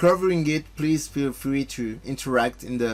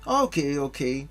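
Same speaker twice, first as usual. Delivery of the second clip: lightly voiced young heroes, a bit higher; game dialogue voices; 5 semitones higher than usual. Streamer reading game dialogue to his chat, lightly voiced. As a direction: read the cutscene lines with a little character.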